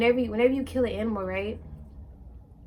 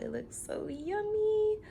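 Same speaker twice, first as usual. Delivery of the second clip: cutesy delighted; 11 semitones higher, 5 dB quieter, slower than usual. Real, not acted. Showing off her plate of food.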